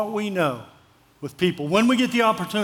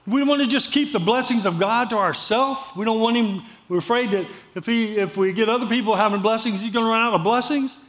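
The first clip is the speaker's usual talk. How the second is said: ordinary delivery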